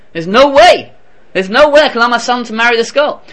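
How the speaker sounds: furious mother forbids the marriage; indignant refusal. Acted. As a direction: slam the door on the match. Outraged mother refuses the match.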